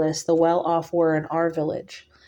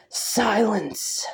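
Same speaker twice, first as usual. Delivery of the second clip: sharp command in a raised pitch, drawn out; snapped; 4 semitones higher than usual. A narrator's reading. Creature silencing the pleading father, snapped order. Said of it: "the creature snapped".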